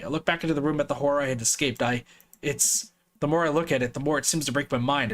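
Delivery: usual speaking voice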